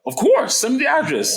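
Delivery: eager yes. Retold or imitated. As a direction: jump at the deal. Eager reply to seller.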